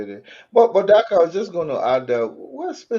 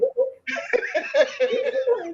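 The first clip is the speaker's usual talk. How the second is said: high laugh; 3 dB quieter than usual. Real, not acted. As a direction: burst of high-pitched laughter. Laughing at own joke.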